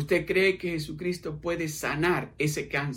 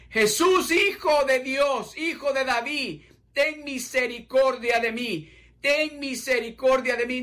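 clamoring plea; 5 semitones higher than usual. Acted. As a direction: cry out to Jesus with everything. Listener clamoring to God.

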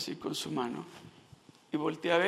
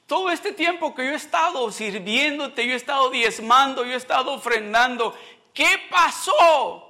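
loud, high complaint to God; raised-voice pleading, demanding an answer; anguished protest; 6 semitones higher, 5 dB louder than usual. Retold or imitated. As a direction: cry out in protest, drawing out the final question.

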